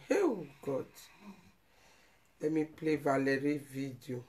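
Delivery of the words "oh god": soft, broken sigh of God; almost crying out to God, softly; quiet pained exclamation; choked lament. Real, not acted. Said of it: "the thing touch me".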